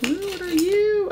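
high, drawn-out ooh of wonder; delighted surprise. Real, not acted. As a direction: coo at a surprise find.

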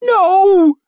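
very high, loud, stretched scream; terrified squeal. A narrator's reading. Maid as the mob drags her inside, squealing. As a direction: squeal in horror. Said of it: "she squealed".

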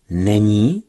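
disbelieving rising echo; astonished repeat. Acted. Lien hears sub is empty, astonished. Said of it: "opakoval úžasle Lien".

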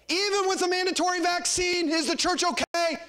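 high, ringing preacher's challenge; urgent, high-pitched rhetorical question; rousing preaching. Real, not acted. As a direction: call it out to rally the congregation. Preacher rallies congregation with questions.

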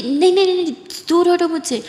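high, loud startled cry; panicked shout. Acted. Kiran shouts at Aditi to get away, panicked. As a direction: yell it as you jump away. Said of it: "ऐसे चिल्लाते हुए उठ जाती है".

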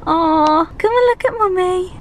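high cooing call to the kitten. Real, not acted. Calling the kitten over.

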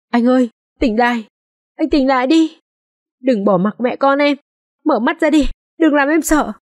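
high, frantic pleading to wake him; panicked pleading. A narrator's reading. Wife shaking her stabbed husband, frantic high pleading. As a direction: beg him to open his eyes, terrified. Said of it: "hốt hoảng lay gọi".

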